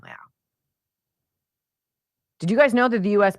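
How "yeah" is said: normal talking voice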